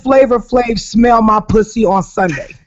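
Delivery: usual speaking voice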